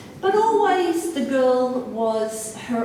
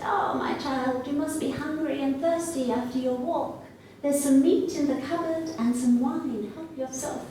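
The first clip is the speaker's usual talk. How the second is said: higher, sweet-talking fake granny; wolf posing as grandmother; 4 semitones higher than usual. Wolf posing as grandmother welcomes the girl, higher granny voice. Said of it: "the wolf said".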